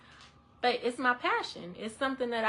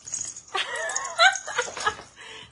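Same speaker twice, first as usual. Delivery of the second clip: high breathless laugh breaking into a cry; laughing sob; 4 dB louder than usual. Real, not acted. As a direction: laugh helplessly until it almost turns into tears. Overcome, laughing and crying.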